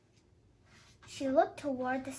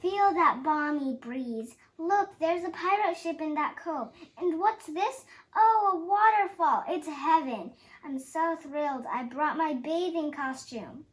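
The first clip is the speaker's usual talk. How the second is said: louder, higher, gushing with joy; thrilled delight; 4 semitones higher, 9 dB louder than usual. A narrator's reading. Madame Bada Bada exclaims over the paradise, thrilled and loud. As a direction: swept away, she cries out each wonder.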